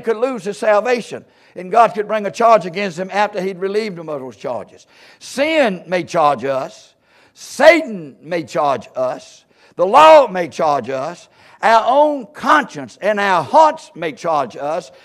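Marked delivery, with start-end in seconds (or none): 5.2-14.9 slow, drawn-out key words; weighty preaching list. Real, not acted. Preacher listing who may charge us, slow and weighty. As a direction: hammer out each accuser deliberately.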